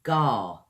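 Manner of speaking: gar from the back of the throat; throaty gar. Shown as a demonstration. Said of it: "back of the throat there when you say".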